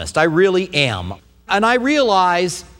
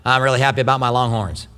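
imitating a friend's Maine accent, lower in pitch; northeastern accent; 6 semitones lower than usual. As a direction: put on a low New England accent. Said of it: "He's got that northeastern accent".

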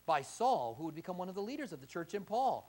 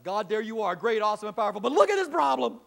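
mock complaint; 4 semitones higher, 11 dB louder than usual. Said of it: "it's like".

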